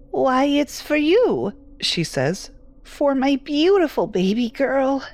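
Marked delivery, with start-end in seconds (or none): 0.1-1.5 put-on high, slow, sweet elderly mother; tender old woman voice; doting old mother. 2.8-5.0 put-on tender old woman voice; doting old mother.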